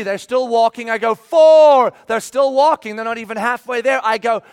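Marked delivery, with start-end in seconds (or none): 1.1-1.9 loud, high shout of the number; shouted count. Retold or imitated. Pastor shouting the count at his dawdling kid, loud. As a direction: bark the count across the yard.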